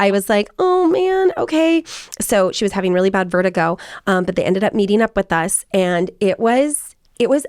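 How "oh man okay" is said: high, retold "oh man" disappointment; let-down reaction. Daughter hears mom is unwell, high mock-disappointed reply. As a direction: voice a mild, high-pitched letdown.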